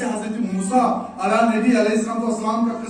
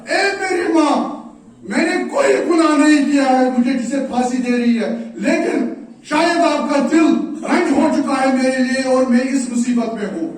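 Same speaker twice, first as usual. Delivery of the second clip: calling mother; 2 semitones higher, 6 dB louder than usual. Retold or imitated. Son crying out to his mother in the crowd, loud. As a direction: call out to her across the square.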